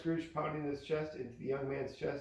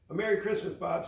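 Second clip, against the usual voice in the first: higher, heartfelt greeting; sincere; 6 semitones higher, 5 dB louder, faster than usual. A narrator's reading. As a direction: Scrooge wishes his clerk merry Christmas, sincere. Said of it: "his voice was so sincere".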